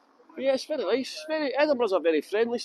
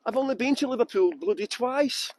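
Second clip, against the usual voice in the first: English accent turning Scouse. Shown as a demonstration. Guide explaining his failed English to viewers, Scouse-tinged English accent. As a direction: attempt English and slide into Scouse. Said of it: "it turns into a Scouser".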